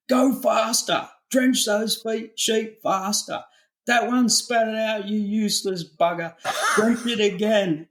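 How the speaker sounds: high-pitched mock yell of a harsh overseer; retold overseer yelling. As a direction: bark orders like a boot camp sergeant. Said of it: "screaming at me".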